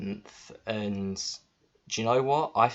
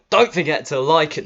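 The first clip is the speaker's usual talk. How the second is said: loud, higher, hammed-up channel plug; mock YouTuber outro; 7 semitones higher, 11 dB louder than usual. Vlogger mocking a cheesy outro to viewers, loud and hammed-up.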